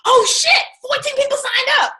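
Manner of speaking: high, loud burst of delight; thrilled squeal over fourteen signups; excited. Retold or imitated. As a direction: shriek with surprise at the signups. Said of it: "You'd be like".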